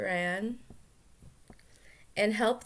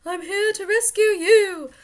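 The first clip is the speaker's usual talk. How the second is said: high, loud hero voice; heroic Luke; 12 semitones higher, 12 dB louder than usual. Acted. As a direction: announce the rescue boldly. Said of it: "Luke says".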